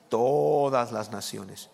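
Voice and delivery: "todas" held very long; drawn-out TODAS. Real, not acted. Preacher answering his own question from the verse, "todas" drawn out. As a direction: stretch out "todas" slowly for emphasis. Repeats the verse word after asking.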